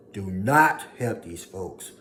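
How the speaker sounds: slow, loud, hitting not; emphatic warning. Real, not acted. Warning listeners against false friends, slow and loud. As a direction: hammer every word. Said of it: "emphatic command".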